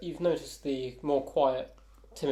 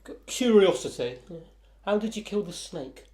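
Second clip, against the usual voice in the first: higher and louder, eager curiosity; eager dwarf; 7 semitones higher, 6 dB louder than usual. Acted. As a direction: lean in, hungry to know how. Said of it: "Looks at you guys quite eagerly now".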